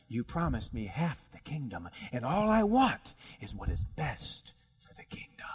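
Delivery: soft plea sinking into a whisper; hushed appeal. Acted. Queen reminding the king of his promise, hushed and whispering. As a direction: start softly and fade to a whisper.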